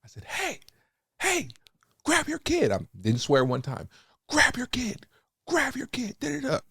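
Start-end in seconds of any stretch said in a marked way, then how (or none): 0.3-2.7 much higher, shouted call across the field; retold shout. Confronting the other parents. 4.3-6.6 much higher, shouted call across the field; retold shout. Confronting the other parents.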